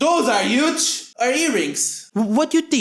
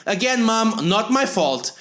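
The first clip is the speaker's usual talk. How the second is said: usual speaking voice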